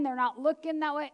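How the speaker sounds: ordinary delivery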